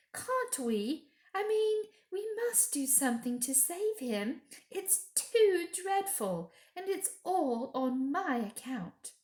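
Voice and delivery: put-on high girlish voice, pleading, slowing down; a little girl desperate to save her friend; high young girl, urgent and upset; young Lucy, pleading.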